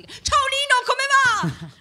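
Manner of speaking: very high, slow, bubbly TV host voice; Disney Channel girl. Retold or imitated. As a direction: chirp it like a kids' TV presenter. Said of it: "sembravo veramente la tipa de-, di Disney Channel".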